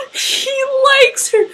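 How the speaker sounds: very high, loud fangirl squeal; ecstatic squeal. Retold or imitated. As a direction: squeal it out, overjoyed. Re-enacting her realisation of romance.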